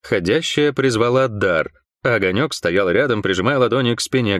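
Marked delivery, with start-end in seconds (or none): none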